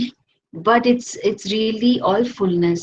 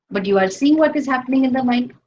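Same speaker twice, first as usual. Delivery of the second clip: ordinary talk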